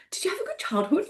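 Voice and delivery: high-pitched tentative mum question; mum asking kids. Retold or imitated. Acts out asking her children.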